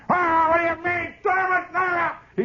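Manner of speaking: imitated furious high yell; retold yelling. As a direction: yell it out high and drawn, as a raging schoolboy. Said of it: "He's yelling like that".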